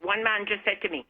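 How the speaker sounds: normal talking voice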